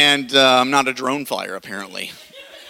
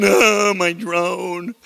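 mock moping; 3 semitones higher, much slower than usual. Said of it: "like, moping".

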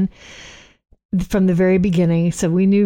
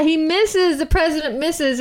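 normal talking voice

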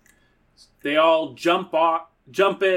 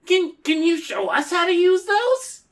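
put-on high, eager kid voice; wholesome 1950s kid; 14 semitones higher than usual. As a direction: beg like a wide-eyed farm boy. Kid voice in Western bit.

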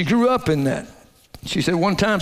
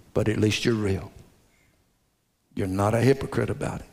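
gentle, sincere reassurance; 7 semitones lower than usual. Acted. Preacher telling a broken person they are real, tender and low. Preacher voicing what he'd say.

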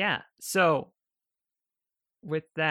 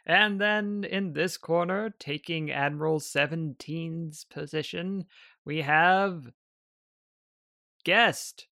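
raised, loud ring announcer voice; booming arena announcer; 3 semitones higher, 4 dB louder than usual. Acted. Game master introduces the other semifinal fighter, booming announcer voice. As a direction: call out the fighter with a suspenseful pause. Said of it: "booming voice of an announcer".

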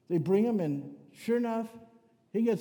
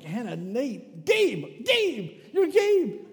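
starts slow, then climbs much higher and louder on each Gabe; a frazzled dad fumbling through his kids' names until he lands on Gabe; tripping through the names, then hitting Gabe high and loud; flustered name list; 6 semitones higher, 7 dB louder, much slower than usual. Retold or imitated. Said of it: "I had to go down the list".

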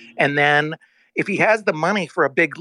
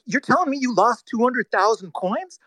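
higher pitched, incredulous question; shocked disbelief; 6 semitones higher than usual. Retold or imitated. Retelling his call with the CEO, higher and incredulous. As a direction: sound stunned that he never said it. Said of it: "in shock".

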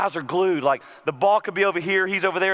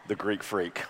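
low, hushed and reverent, much softer; awed hush; 10 semitones lower, 9 dB quieter than usual. Acted. The preacher recalling how his boys beheld the star, hushed in awe. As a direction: breathe the name in wonder.

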